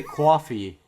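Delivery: "coffee" as "kwaw-fee", Brooklyn accent; thick Brooklyn "coffee". Shown as a demonstration. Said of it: "That's Brooklyn".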